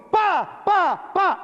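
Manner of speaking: high punchy pa, pa, pa impact sounds; imitated crash. Survivor mimicking the building falling on the truck, high imitated crash. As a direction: mimic debris slamming down.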